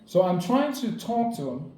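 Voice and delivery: ordinary talk, nothing marked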